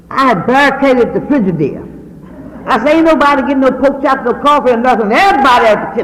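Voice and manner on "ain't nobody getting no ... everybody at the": higher and louder mock yell, faster at the end; retold warning yelled at the girls to stay back; retold shout. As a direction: bark it out like you're guarding the fridge. Barricading the fridge, calling out.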